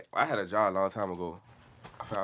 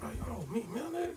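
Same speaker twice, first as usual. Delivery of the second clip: incredulous whisper; 12 semitones higher, 10 dB quieter than usual. Retold or imitated. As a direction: whisper it in disbelief. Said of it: "I was like".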